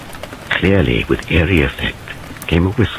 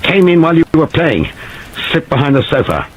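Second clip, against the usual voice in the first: clipped and forceful, higher; short explosive sentences; 11 semitones higher than usual. Acted. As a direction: fire it off in bursts. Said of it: "short, explosive sentences".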